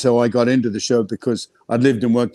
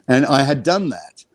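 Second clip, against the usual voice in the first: ordinary delivery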